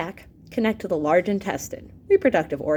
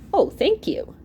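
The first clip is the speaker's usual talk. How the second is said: a thank you; 9 semitones higher than usual. Acted. Host thanked for a delivered message.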